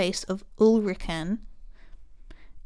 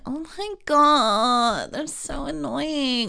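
high, long-drawn whine; slow, high-pitched mock complaint; Lani voice; 5 semitones higher, much slower than usual. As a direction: drag it out in a whiny American-style drawl. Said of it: "You're doing a Lani voice?".

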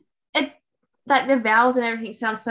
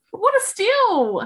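playful amazement; 9 semitones higher, 3 dB louder, much slower than usual. Acted. Mock-impressed reaction to cheap pizza.

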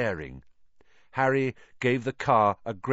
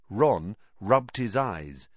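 ordinary delivery

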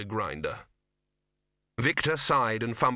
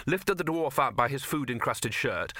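usual speaking voice

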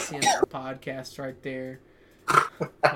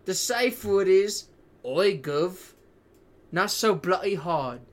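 higher, louder mock cockney rogue; hammed-up British accent; 6 semitones higher, 6 dB louder, slower than usual. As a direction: camp it up like a saucy Victorian villain. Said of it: "in that same voice".

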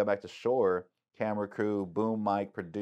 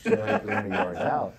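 amazed laugh; 11 semitones higher, 7 dB louder than usual. Retold or imitated. Retelling drifting far out.

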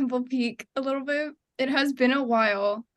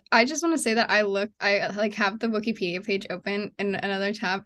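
ordinary talk

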